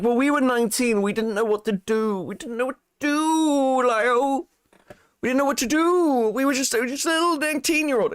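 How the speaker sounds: high, whining mimicry, sped up at the end; mocking whiny voice. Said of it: "It's like".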